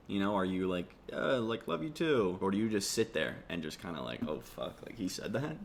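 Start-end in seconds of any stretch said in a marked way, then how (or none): 1.1-2.4 awkward reply. Retold or imitated. 4.3-5.5 a quiet shocked aside to yourself; hushed disbelief, much softer; stunned mutter. Retold or imitated. Stunned silent reaction.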